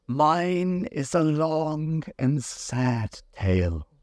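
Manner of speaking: slow, high mournful mouse; a touch of sorrow, drawn out; sighing sadly. A narrator's reading. Mouse sighing to Alice, slow and mournful. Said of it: "said the mouse, turning to Alice and sighing".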